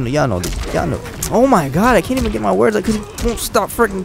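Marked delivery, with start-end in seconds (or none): 1.3-4.1 high-pitched frustrated complaining; exasperated rant. Real, not acted. Gamer ranting at an opponent spamming kicks, exasperated. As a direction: vent at the screen, fed up. Opponent spams same kick.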